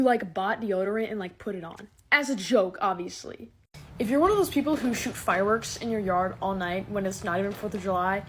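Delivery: usual speaking voice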